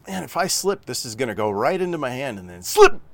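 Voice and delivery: mock alarm spiking high and loud on the slip; imagined panic bursting out at the slip; startled cry. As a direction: act out the screwdriver slipping into your hand. Imagined screwdriver slip, sudden jolt.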